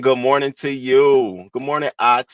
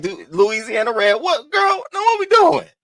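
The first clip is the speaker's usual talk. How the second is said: playful quote; 11 semitones higher than usual. Retold or imitated. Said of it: "I'm like".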